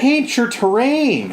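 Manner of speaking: high, drawn-out heckle; teasing call-out. Real, not acted.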